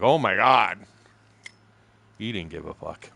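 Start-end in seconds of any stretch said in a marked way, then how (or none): 0.0-0.8 mock-shocked exclamation, louder; loud retold gasp of disbelief in words; shocked crowd reaction. Everybody in the place reacting, mock shock. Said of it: "everybody in the place would be like".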